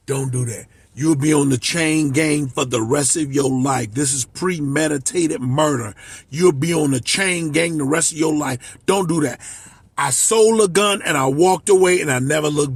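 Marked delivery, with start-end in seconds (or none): 0.1-9.4 grandma's gentle warning. Retold or imitated. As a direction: hush him like a worried grandma. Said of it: "My grandma told me".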